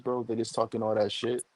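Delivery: ordinary talk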